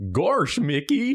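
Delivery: high slow Goofy voice; Goofy impression. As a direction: drag out gosh like a dopey dog. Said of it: "Goofy's all like".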